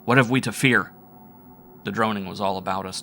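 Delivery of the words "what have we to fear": alarmed. A narrator's reading. Narrator crying out.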